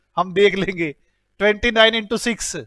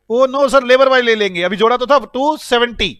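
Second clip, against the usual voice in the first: mock student protest; 4 semitones higher, 6 dB louder than usual. Teacher voicing students' protest.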